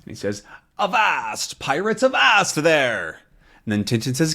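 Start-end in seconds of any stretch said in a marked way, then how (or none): 0.8-3.2 higher, hammed-up pirate shout; mock pirate call; pirate captain cry. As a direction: bellow it like an old sea captain.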